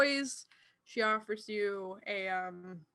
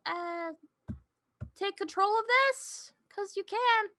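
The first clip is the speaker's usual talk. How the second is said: ordinary delivery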